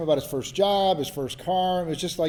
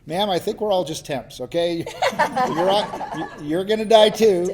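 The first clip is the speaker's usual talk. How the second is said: laughing louder, pitch climbing up; laughing reply; 5 semitones higher, 6 dB louder than usual. Retold or imitated. To the furious woman after the talk, laughing reply. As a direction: chuckle through the comeback, amused.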